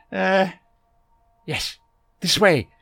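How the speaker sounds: eccentric Doctor voice. A narrator's reading. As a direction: bustle in as a fussy, kindly eccentric.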